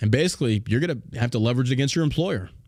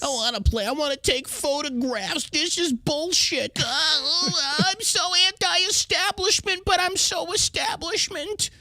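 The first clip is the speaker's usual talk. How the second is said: much higher, slow fake-crying whimper; mock crybaby whine; 13 semitones higher, slower than usual. Host mocking Howard Stern as a whiny crybaby, fake sobbing. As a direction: blubber like a pampered baby.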